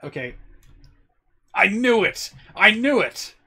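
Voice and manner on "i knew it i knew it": louder, higher exclamation; excited at a confirmed hunch; real triumphant excitement. Real, not acted. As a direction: burst out, vindicated. Hunch about the voice actor confirmed.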